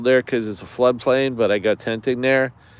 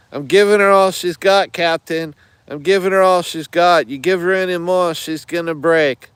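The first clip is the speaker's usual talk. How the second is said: put-on Scottish accent; 6 semitones higher than usual. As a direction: plead in a hammed-up Scottish accent. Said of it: "that was my Scottish accent".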